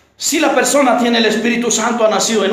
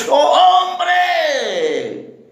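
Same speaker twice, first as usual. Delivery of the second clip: high, drawn-out preacher's cry; long exasperated pulpit shout; exclaimed rebuke; 4 semitones higher than usual. Real, not acted. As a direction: cry it out long, falling away at the end. Preacher cries out rebuking congregation.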